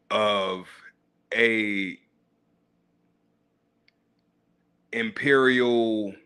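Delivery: deliberately slow, word-by-word reading; slow exaggerated reading. Shown as a demonstration. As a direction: read it out slowly and pompously. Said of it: "It might say something like".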